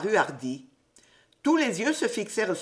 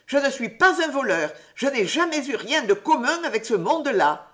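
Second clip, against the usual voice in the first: higher, louder outraged denial; boy denies being a thief, indignant and raised; indignant cry; 6 semitones higher, 4 dB louder than usual. A narrator's reading. A raised, wounded protest. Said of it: "s'écria Hardy indigné".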